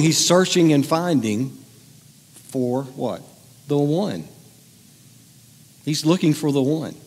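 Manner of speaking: ordinary talk, nothing marked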